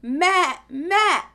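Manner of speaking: loud high goat bleat. Shown as a demonstration. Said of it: "Can you bleat like a goat?".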